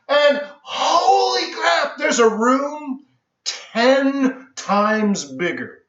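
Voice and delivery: high, very slow astonished build; retold jaw-drop at the huge room; stunned. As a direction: let the size of it hit you. Turned to see bigger room.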